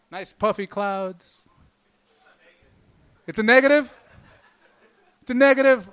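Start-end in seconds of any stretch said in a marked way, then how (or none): 3.3-3.9 louder, raised question to the crowd. Real, not acted. Comic shouting at the audience because he can't hear them. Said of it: "I can't hear shit". 5.2-5.9 louder again, falling at the end; loud repeated question. Real, not acted. Comic repeating his shouted question to the crowd. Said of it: "I can't hear shit".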